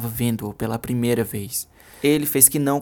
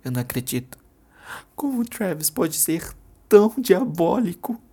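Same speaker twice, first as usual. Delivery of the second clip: stunned; 6 semitones higher than usual. Acted. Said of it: "Ela estava passada".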